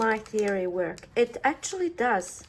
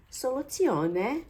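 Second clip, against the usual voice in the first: slow, higher mock Italian accent; 4 semitones higher, slower than usual. Crafter unveiling her solution to viewers, slow mock Italian accent. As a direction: announce it grandly in a put-on Italian accent.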